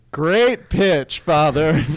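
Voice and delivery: high, loud announcer call; PA announcer cheering the priest, high and loud. Retold or imitated. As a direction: boom it out like a ballpark PA. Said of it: "And then he's like".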